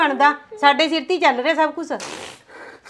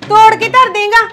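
angry shouting; 4 semitones higher, 3 dB louder than usual. Acted. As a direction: threaten him loudly. Sister scolds brother breaking gate. (ਉੱਚੀ ਗੁੱਸੇ ਵਾਲੀ ਡਾਂਟ)

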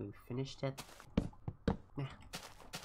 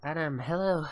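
calling out; 3 semitones higher, 8 dB louder than usual. Real, not acted. Greeting a player who joins.

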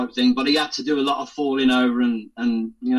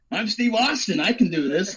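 a lightly voiced kid playing a TV hero, higher; mock heroic, a touch higher, ending in a laugh; playing Steve Austin; 6 semitones higher than usual. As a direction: boast like a little boy pretending to be the bionic man. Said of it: "so there's me going".

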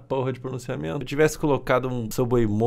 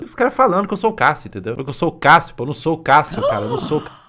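loud, higher-pitched outcry; exasperated protest; 4 semitones higher, 8 dB louder than usual. Acted. Streamer insisting he is not Cássio, loud exasperated protest. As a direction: protest loudly, climbing to a high cry at the end.

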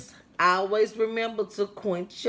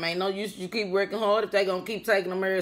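ordinary delivery

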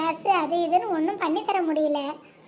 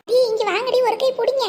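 higher, louder call for help; 6 semitones higher, 5 dB louder than usual. Acted. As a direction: shout them over to lend a hand. Calling the women to help carry. (உதவிக்கு உரக்க அழைத்தல்)

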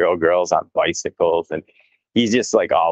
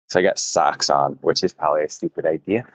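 ordinary delivery

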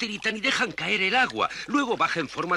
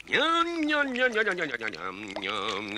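ñam, ñam, masticando con gusto; munching noises; 5 semitones lower, slower than usual. Acted. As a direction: come relamiéndose. Munching contentedly. (saltamontes comiendo feliz, haciendo ñam ñam)

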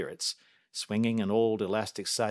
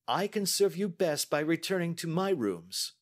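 lightly voiced calm detective, higher; a brisk, confident Holmes; the detective testing the banker, lightly voiced; Holmes, cool and measured; 9 semitones higher than usual. A narrator's reading. Holmes voiced while reading.

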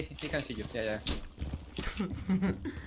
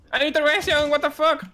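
excited shout; 12 semitones higher, 5 dB louder than usual. Real, not acted. Surprised gamer yelling in match.

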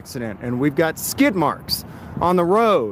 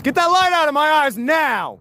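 sharp demand, raised voice; shouting; 7 semitones higher than usual. Real, not acted. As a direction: yell it at the officer. Police light in his eyes.

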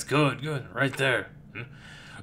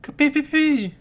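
very high, loud fly squeak; fly chirp; 13 semitones higher, 6 dB louder than usual. Acted. Fly creature noise.

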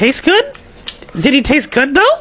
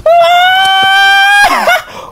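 high, slow hammed-up wail; mock grief cry; mock anguish; much slower than usual. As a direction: wail over the lost baby. Egg cracked as Donald's baby.

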